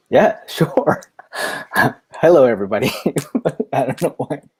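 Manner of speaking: helpless giggles breaking into the greeting; real giggling. Real, not acted. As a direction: try to say hello through real laughter. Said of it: "laughing so much right now".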